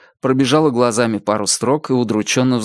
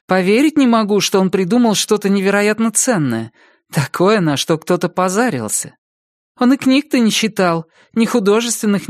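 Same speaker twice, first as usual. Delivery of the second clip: higher woman's voice, doubting; lightly voiced incredulous ex-wife; 8 semitones higher than usual. Ex-wife doubting Igor ever did science, lightly voiced disbelief. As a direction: lightly pitch it up, unconvinced.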